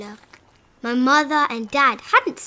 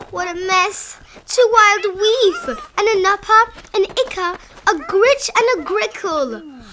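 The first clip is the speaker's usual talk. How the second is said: panicked; 7 semitones higher, 5 dB louder than usual. Acted. Boy panics at the crowd.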